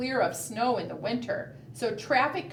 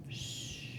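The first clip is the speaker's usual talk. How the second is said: quiet whispered shh; gentle unvoiced shushing; soft shush; 14 dB quieter than usual. Shown as a demonstration. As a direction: hush the sleeping bear. Bear sleeps, shushing.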